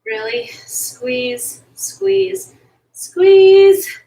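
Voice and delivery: building squeeze cue. Real, not acted. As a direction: coach ramping up the squeeze. Coach urging through the hold.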